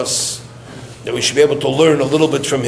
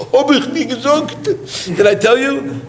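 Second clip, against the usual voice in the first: higher, laughing mock exclamation; laughing imitation; 11 semitones higher than usual. The mayor reacting to the plan, high and laughing. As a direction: laugh while voicing the upset mayor.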